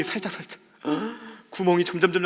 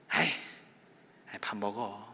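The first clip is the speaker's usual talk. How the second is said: much softer, lower, gentle grumble; soft reconciling reply; 7 semitones lower, 9 dB quieter than usual. Retold or imitated. Family member softly answers the breakfast invitation, quiet making-up.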